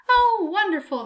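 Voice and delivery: put-on high, bright-eyed old woman's glee; pleased as punch about an injury; a hammed-up cheery examiner; delighted old grader. A narrator's reading.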